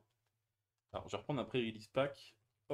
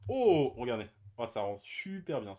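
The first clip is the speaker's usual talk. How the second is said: excited; 8 dB louder than usual. Real, not acted. Excited at shiny card.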